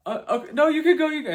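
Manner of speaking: high, loud, flustered boyfriend imitation; high panicked mock voice. Boyfriend caving after she snaps, high loud panicky voice. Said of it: "And then he's like".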